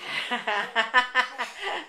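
soft amused chuckle; warm laugh. Real, not acted. Laughs at babies.

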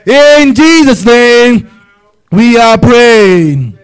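slow, stretched closing of the prayer; slow solemn prayer ending; drawn-out closing. Acted. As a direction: draw out every word to seal it. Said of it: "drawn-out closing formula".

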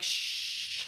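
hushed, breathy shh; shushing whisper. Retold or imitated. Hushing partner who says it's noon, whispered shh. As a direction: wave off the wake-up call. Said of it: "she's like".

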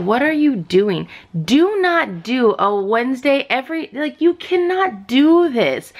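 exasperated, dragging each word out; angry scolding. Real, not acted. Vlogger scolding Funko over sold-out release, angry and strained. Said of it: "I'm so angry".

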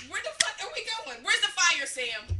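high, loud mock protest; exaggerated passenger outcry; acted-out alarm. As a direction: yelp at a driver who's going too fast.

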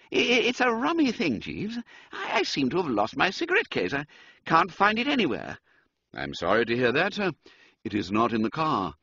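high, stammering, frazzled toff; worn-out Bertie fretting over his case; tired, flustered upper-class voice; fatigued young gentleman. Acted. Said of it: "manner was a trifle fatigued".

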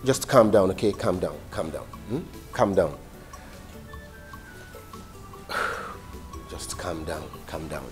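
soft, low calming repeats; soothing. Acted. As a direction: hush her gently. Soothing crying sister.

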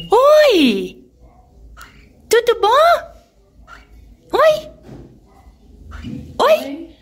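squeaky high pet talk. Real, not acted. Greeting the birds in baby talk.

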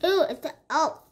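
talking through a mouthful of chocolate; chocolate still in the mouth; mouth full. Real, not acted. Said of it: "Sorry if I'm talking weird".

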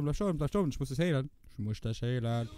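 normal talking voice

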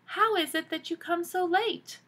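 put-on child voice. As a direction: ask why so late. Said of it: "Shang said".

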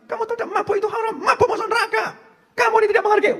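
put-on sanctimonious scold to a drunk man; mock scolding preacher. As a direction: lecture the drunk in a squeaky, self-righteous voice.

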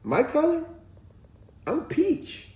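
put-on very high child voice; high-pitched, innocent kid impression; little boy. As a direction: imitate a small boy answering innocently. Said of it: "And he said".

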